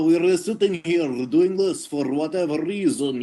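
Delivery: a hint of a hammed-up actor voice; put-on audition voice. Waiting actors showing off in a light put-on voice. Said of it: "talking in a particular voice".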